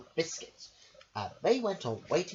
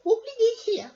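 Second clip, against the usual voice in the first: much higher, squeaky little lady voice; Missus Armitage voice; 9 dB louder than usual. Acted. Missus Armitage asks her dog a question, high and squeaky. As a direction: pipe it up like a fussy little lady. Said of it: "said Missus Armitage".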